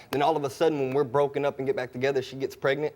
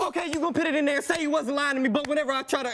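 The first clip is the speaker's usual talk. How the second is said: high, strained shouting; angry shouting; 12 semitones higher than usual. Real, not acted. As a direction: yell the accusation at her. Shouting match with girlfriend.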